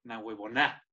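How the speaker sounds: low, quiet "Na huevona", final A stressed; stress on last A. Shown as a demonstration. Host repeating guest's correction, "Na huevona" stressed on the final A. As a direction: copy "Na huevona" softly, accent on the last A. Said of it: "tiene acento en la última A".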